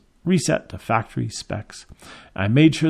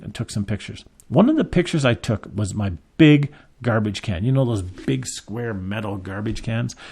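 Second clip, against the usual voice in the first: usual speaking voice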